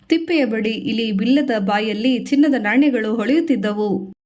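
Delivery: ordinary delivery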